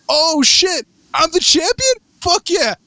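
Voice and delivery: high, excited whoop; thrilled disbelief. Retold or imitated. Crowned alligator champion in the dream, high excited whoop. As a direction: celebrate like you just won the belt. Retold joy at winning title.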